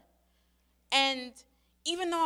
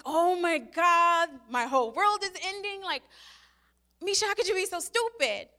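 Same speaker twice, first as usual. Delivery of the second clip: mock despair; 6 semitones higher, 5 dB louder than usual. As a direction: ham up the end of the world. Mocking her own despair.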